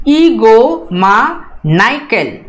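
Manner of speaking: ईगो मा नाइकल read slowly, stress on ईगो; slow model reading of ईगो मा नाइकल; read aloud for repeating. Shown as a demonstration. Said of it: "इसको मैं पढ़ता हूँ, आप भी पढ़िए".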